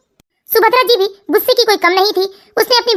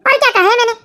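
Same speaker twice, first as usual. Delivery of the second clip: normal talking voice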